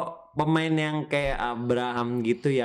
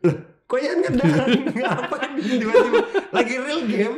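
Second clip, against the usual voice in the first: high laughing disbelief; surprised laughing; 11 semitones higher, 4 dB louder than usual. Retold or imitated. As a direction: exclaim it through laughter. Retelling surprise at teammate dunk.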